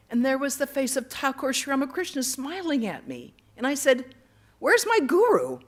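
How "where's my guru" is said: high, loud retold cry of disbelief; incredulous question. Devotee asks the smiling saint for the guru, incredulous and high. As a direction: call it out, pitched well up.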